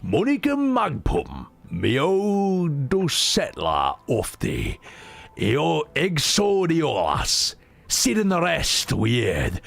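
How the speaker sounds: put-on slow, halting recital of old verse; Old English reading. Shown as a demonstration. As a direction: sound out each strange word slowly. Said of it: "how English used to be a thousand years ago".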